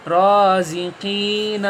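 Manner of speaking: Raziqeen stretched slowly with the long ی held; slow blended word. Shown as a demonstration. Teacher finishing the word Raziqeen, slow. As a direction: draw out Raziqeen. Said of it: "یہ تو ہجے ہو گئے۔".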